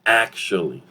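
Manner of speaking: "actually" as ak-shuh-lee, middle uh weakened; reduced form. Shown as a demonstration. Teacher asking learners to repeat, reduced "actually" as ak-shuh-lee. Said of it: "Repeat after me".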